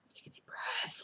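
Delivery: hushed whisper after a sigh. Real, not acted. Fan calming herself before the trailer, whispered. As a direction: whisper it to steady yourself.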